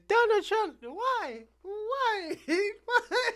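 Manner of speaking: high whiny wail with laughter; laughing mock sob of Cuban lady; mock crying voter. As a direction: imitate her tearful pleading. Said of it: "All I gotta say is".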